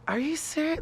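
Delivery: lightly voiced stunned instructor; mock incredulous.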